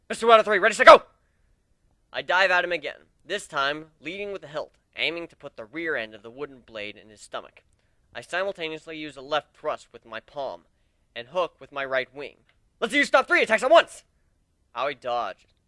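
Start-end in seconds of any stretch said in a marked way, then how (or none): 0.1-1.0 rattled off at top speed, loud and high; extremely fast voice. A narrator's reading. Narrator voicing himself, rushing out the challenge fast. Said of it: "speak in an extremely fast voice". 12.8-14.0 excited battle cry. A narrator's reading. Excited battle cry mid-fight.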